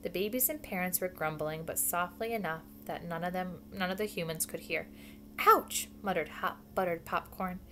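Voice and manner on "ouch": loud, high-pitched yelp; pained yelp. A narrator's reading. Animal squashed in the crowded tree, pained yelp. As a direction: yelp as if trodden on.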